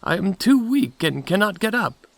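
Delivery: put-on high-pitched old granny; weak grandmother. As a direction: plead from bed in a high granny voice. Grandmother calls from her bed.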